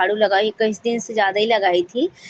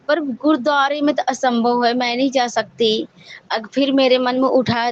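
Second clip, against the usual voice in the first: ordinary talk, nothing marked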